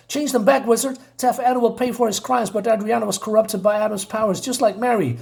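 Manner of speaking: put-on high-pitched young hero pleading; urgent plea. Billy begging the wizard to help, high-pitched urgent plea. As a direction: plead for your friends in a sustained high voice.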